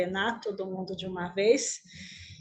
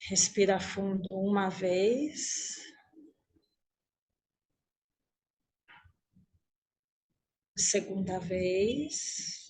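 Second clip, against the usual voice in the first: guided meditation; 3 dB quieter than usual. Acted. Teacher leading the group lying on imagined grass, guided meditation. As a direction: guide them gently inward, unhurried, leaving room to breathe.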